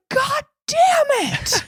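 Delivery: high, slow, loud yell; mock furious yell. Quoting Peter's outburst, a high slow mock yell. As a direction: repeat the crazy yell.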